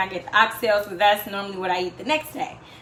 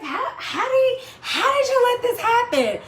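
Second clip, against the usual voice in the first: much higher, incredulous mock scolding of herself; self-scolding; 14 semitones higher than usual. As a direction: demand in disbelief how she let it happen.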